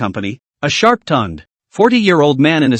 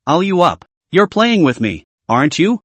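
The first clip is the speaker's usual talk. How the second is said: plain reading voice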